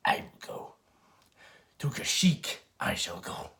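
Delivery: slow, croaked, badly faked little Jedi master voice; halting, half-voiced creature impression; Yoda. Jedi master volunteering for Kashyyyk, badly faked croaky impression. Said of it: "I've done better Yoda impressions in my life".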